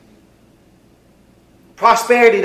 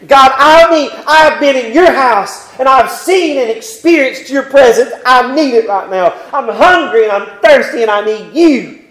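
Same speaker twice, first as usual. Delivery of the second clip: high, loud pleading sermon voice; preacher building to a passionate plea; fervent preaching; 6 semitones higher, 7 dB louder than usual. Acted. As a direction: plead it out from the pulpit. Preaching the Psalm at full force.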